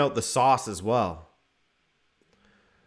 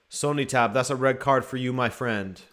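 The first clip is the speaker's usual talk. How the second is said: ordinary talk, nothing marked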